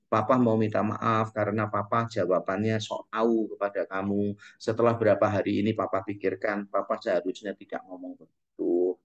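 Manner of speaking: put-on soft, low apology to his child; a father humbly apologising to his son, softly; gentle apology. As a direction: say sorry quietly and sincerely.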